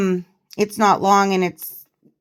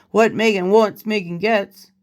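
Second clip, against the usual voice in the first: ordinary talk, nothing marked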